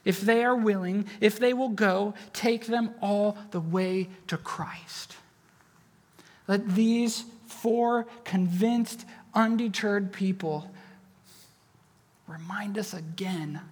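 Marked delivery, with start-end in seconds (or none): 2.3-5.1 hushed, slow whisper. Real, not acted. Soft intimate sermon close. 12.3-13.6 hushed whisper; soft confiding whisper. Real, not acted. Soft intimate sermon close.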